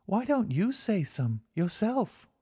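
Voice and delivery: put-on high, slow little girl asking in wonder; wondering child. A narrator's reading. Girl asks the man why he won't pray, wondering. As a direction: ask innocently, full of wonder. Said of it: "the child asked with wondering eyes".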